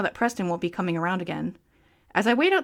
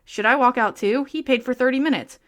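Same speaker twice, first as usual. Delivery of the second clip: ordinary talk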